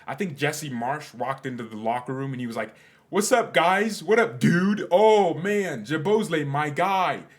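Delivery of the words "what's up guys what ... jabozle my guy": much higher and louder, hyped American coach greeting; mock American accent. Jesse Marsch greeting his players, loud mock American accent. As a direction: play a bro-ish American coach barging into the locker room. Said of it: "You know, American accent".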